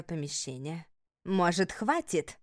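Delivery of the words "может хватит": growling. A narrator's reading. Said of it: "рыкнула на меня Вакири".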